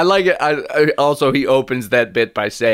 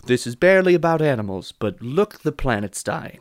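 usual speaking voice

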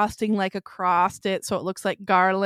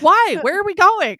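mock indignant protest; 9 semitones higher, 5 dB louder than usual. Retold protest to mom.

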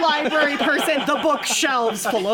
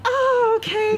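high, sing-song "Oh, okay" in mock politeness; hollow polite brush-off, pitched up; 10 semitones higher than usual. Answering her date's school, falsely polite. Teller acting unimpressed reply.

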